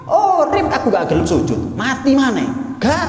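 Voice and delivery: high, indignant mock protest; lightly hammed-up devil balking at an order; Satan's refusal. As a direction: refuse outright in a high-pitched voice. Satan refuses to bow, acted.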